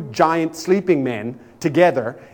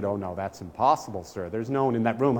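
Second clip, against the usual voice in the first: calm desk clerk; 4 semitones lower, 6 dB quieter than usual. Retold or imitated. Hotel desk clerk on phone.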